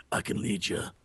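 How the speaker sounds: low gruff road-warrior growl; deep, quiet, grizzled leader; gruff road man. Acted. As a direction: play a gruff, low-voiced wanderer. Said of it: "very good gruff road man".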